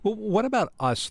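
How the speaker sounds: usual speaking voice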